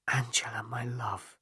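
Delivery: soft breathy whisper of her name. A narrator's reading. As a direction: whisper it close, tender. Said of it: "he whispered".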